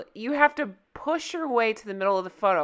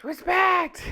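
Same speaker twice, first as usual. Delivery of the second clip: yelled; 9 semitones higher than usual. Shown as a demonstration. Reading a caps headline aloud, she yells it. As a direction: yell the word like it's in all caps. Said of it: "I always feel like I need to yell when I see it in all caps".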